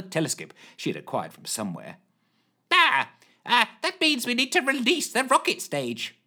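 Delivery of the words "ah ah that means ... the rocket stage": high flustered pronouncement; Lushthorpe voice. A narrator's reading. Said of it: "pronounced Lushthorpe".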